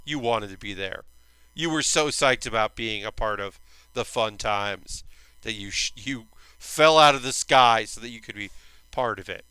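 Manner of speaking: low, soft knowing tease; teasing. Retold or imitated. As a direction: hush it like you're ribbing a friend who got caught. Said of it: "And it's like".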